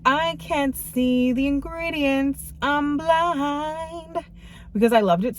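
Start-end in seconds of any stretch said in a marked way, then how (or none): 0.1-4.2 slow, higher, dramatic whine. Retold or imitated. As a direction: play it up like you're blind. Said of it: "I'm like".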